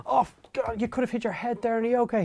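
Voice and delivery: high, fussing voice of a caring dad; mock concern. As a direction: act out the worried reaction he never gave. Concern his father did not show.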